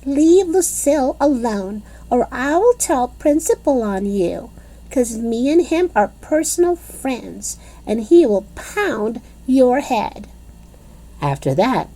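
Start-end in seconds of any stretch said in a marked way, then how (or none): 0.1-10.3 put-on high, bossy kid warning; a touch of a bossy little girl threat. A narrator's reading. Junie B warning the boy she'll tell the Principal, bossy. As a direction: threaten him like a playground tough.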